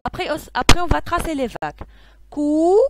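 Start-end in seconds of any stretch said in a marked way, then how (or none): 2.3-2.8 COU held long and loud on its own; isolated syllable. Shown as a demonstration. Teacher tracing the first wave, drawing out the syllable cou. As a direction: draw out cou as a separate beat. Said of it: "je trace la première vague".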